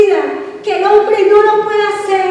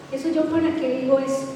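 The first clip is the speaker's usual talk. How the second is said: quick, softer, offhand mock excuse; 6 semitones lower, 7 dB quieter, faster than usual. Preacher mocking a believer's excuse, quick and offhand. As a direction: toss it off like a shrugging believer.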